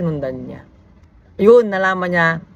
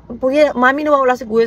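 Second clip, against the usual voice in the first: put-on higher, a bit louder, urgent little boy's voice; imitating the worried younger son; 7 semitones higher, 3 dB louder than usual. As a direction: play the small son reporting his brother is lost. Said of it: "Sabi, ah". (mas mataas na boses ng batang nag-aalala)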